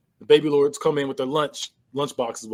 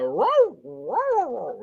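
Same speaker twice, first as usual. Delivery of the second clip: high, drawn-out mock bark; imitated barking; 8 semitones higher, much slower than usual. Owner talking to his dog in barks, imitated barking. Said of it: "was he barking to the dog".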